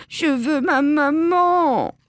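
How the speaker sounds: put-on high, drawn-out child's wail; tearful wail. A narrator's reading. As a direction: whine it out like a weeping child. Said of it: "se met à pleurer".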